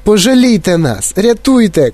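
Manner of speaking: high, desperate plea; crying for help. A narrator's reading. Mother calls for someone to save them, crying out. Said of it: "кричала пані Борегард".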